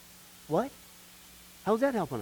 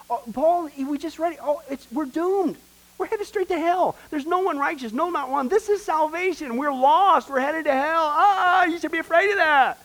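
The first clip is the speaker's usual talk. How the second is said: alarmed preaching; 8 semitones higher than usual. Acted. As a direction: sound the alarm over the congregation. Preacher stirs alarm about hell.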